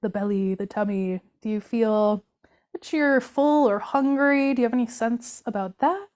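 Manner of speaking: guided body scan. Acted. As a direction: guide them gently through the body, leaving room to feel. Continues the body scan meditation.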